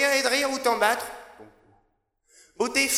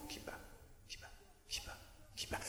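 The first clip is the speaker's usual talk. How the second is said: whisper=43; 28 dB quieter, faster than usual. Acted. Whispered refrain in poem.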